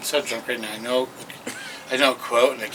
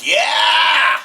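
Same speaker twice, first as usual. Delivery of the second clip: excited shout; 8 semitones higher, 3 dB louder than usual. Real, not acted. Excited cheer for Bukowski.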